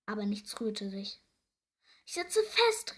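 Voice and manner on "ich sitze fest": high, loud storybook cry; raised, alarmed call. A narrator's reading. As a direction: call out that you're in trouble. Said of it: "rief Tiefsee Doktor Theodor".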